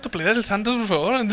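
ordinary talk, nothing marked

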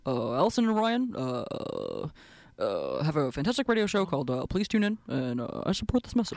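lightly voiced politician ad; presidential endorsement. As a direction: read it like a campaign ad sign-off.

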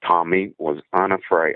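ordinary talk, nothing marked

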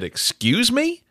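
high, slow mock disbelief; affronted double take, acted out; incredulous. Retelling his disbelief at wife's claim.

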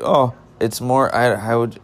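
normal talking voice

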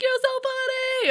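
high, sing-song teasing voice; mock girly voice. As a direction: tease him like giggly girls. Girls teasing him, mocking quote.

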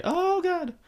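high, startled yelp of oh God; shocked gasp. Retold or imitated. Brother caught naked, startled high yelp. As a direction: squeak it out like you just got caught.

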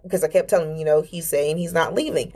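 normal talking voice

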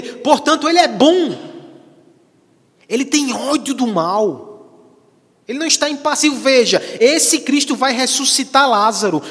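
loud, high-pitched pulpit proclamation; fervent preaching. Acted. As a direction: preach it out at full force. Preaches at full force.

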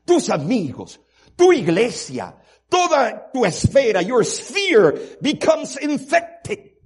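loud, high preacher's cry, slowing to the end; fiery sermon climax; preaching at full force. Acted. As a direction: thunder it from the pulpit.

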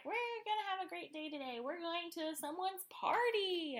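high singsong mock glee; hammed-up, high-pitched chirpy excitement; giddy excitement. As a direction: imitate a giddy date, stretching the final word. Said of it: "looks so giddy and excited to see him".

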